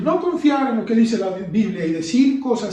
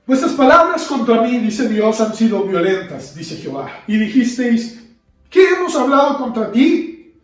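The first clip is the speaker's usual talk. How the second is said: louder, solemn scripture reading; solemn reading of the Bible passage; 5 dB louder than usual. Acted. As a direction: declaim the verses to the congregation.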